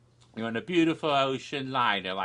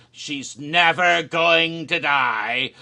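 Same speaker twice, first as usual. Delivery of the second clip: spooky dread; 4 semitones higher, 10 dB louder, slower than usual. Acted. Ominous tease about the ancient Queen.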